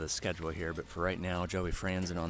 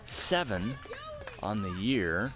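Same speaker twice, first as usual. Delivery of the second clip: higher, louder, slow call of "Franzen"; excited announcer build; 6 semitones higher, 6 dB louder, slower than usual. Acted. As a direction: belt out the stat like a stadium announcer. Big stat announcement.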